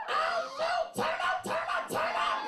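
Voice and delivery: very high shrieked cheering; party hype. Pretend. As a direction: whoop up the crowd in a shriek. Hyping a birthday in audience.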